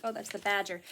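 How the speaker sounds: ordinary delivery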